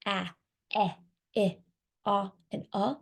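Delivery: "ah, eh, eh, ah, and uh" as separate short vowels; short vowel sounds. Shown as a demonstration. Trainer showing teachers the vowels, isolated short sounds "ah, eh, eh, ah, and uh". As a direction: sound out each short vowel in "ah, eh, eh, ah, and uh", pausing between. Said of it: "our short vowel sounds".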